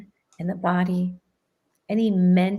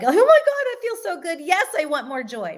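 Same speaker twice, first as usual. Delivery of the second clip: high, loud burst of joy that settles by the end; excited; 8 semitones higher, 5 dB louder than usual. Shown as a demonstration. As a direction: gush like you've just felt amazing. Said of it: "you wanna be excited in your head".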